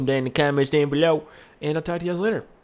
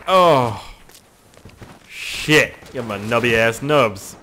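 ordinary talk

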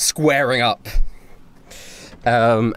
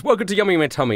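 lightly voiced, higher-pitched waiter; waiter's greeting; 8 semitones higher, much faster than usual. Waiter greeting customers in the story.

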